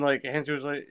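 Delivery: normal talking voice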